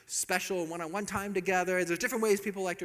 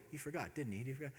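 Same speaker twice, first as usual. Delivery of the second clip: quiet musing; 3 semitones lower, 10 dB quieter, faster than usual. Retold or imitated. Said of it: "she's wondering".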